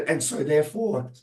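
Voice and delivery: usual speaking voice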